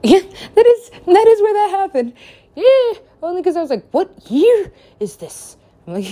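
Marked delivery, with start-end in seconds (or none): 3.9-5.6 high, slow disbelief; bewildered. Retold or imitated. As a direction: stretch each word in high-pitched bewilderment. Acted bewilderment after time jump.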